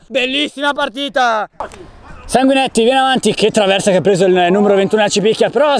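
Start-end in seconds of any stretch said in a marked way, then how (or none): none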